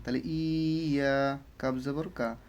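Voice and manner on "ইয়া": slow, stretched "ইয়া"; elongated demonstration of "ইয়া"; drawn-out vowels. Shown as a demonstration. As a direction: hold both halves of "ইয়া" long. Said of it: "ইয়াটাকেও টান".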